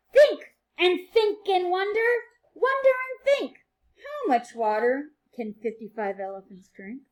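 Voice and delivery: bright, sing-song read-aloud for little ones; reading to children. A narrator's reading. Teacher reading a rhyming book to her class, sing-song and lively.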